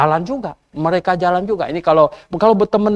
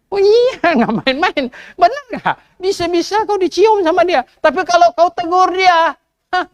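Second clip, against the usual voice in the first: much higher, animated outcry; mock excited exclaim; 10 semitones higher than usual. Preacher teases the congregation, high excited outcry. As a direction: ham up the amazement for the crowd.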